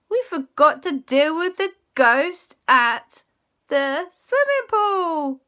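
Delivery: high, slow, dawning horror; a hammed-up gasp of remembering; sudden alarm. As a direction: drag it out as the realisation hits.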